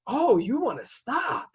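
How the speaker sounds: high, slow, mocking sneer; sarcastic taunt. Mocking the driver who finally stopped, slow and high. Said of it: "And I said".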